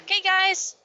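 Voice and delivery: high, bright chirp; Elle's chirpy voice. Acted. Elle chirpily rallying the group at the elevator. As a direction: chirp it cheerfully. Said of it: "she's like".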